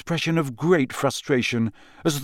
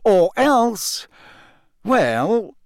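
embarrassed; 9 semitones higher, 4 dB louder, much slower than usual. A narrator's reading. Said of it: "Fudge sounded embarrassed".

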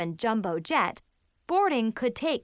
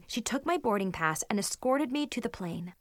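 ordinary delivery, nothing marked

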